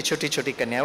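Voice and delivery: ordinary delivery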